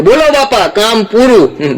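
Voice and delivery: proud ghost reporting back; high ghost announcing the work is finished. Acted. Ghost returns, job done.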